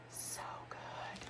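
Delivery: breathy hushed praise; awed whisper. Real, not acted. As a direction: whisper it like a secret delight. Admiring the book.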